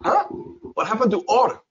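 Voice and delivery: high, puzzled outburst; baffled listener. Acted. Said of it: "when I say comfortable and go".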